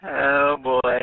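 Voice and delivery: normal talking voice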